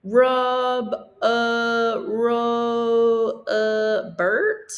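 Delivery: loud, halting announcer mangling the name; stumbling announcer. Acted. Teacher voices the announcer botching Roberto's name, loud and halting. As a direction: fumble the name like a stadium announcer over the PA. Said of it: "announcer stumble through his Spanish name".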